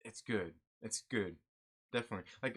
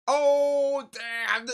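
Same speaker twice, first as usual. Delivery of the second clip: surprised outburst; 13 dB louder than usual. Real, not acted. Reacting to chat's jab.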